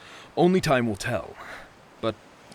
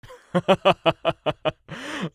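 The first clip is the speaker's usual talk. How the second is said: a bright, higher laugh at a silly guess; amused laugh; 8 semitones higher, 6 dB louder than usual. Real, not acted. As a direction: burst out laughing at her suggestion. Friend's silly guesses at the story.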